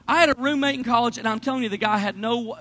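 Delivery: normal talking voice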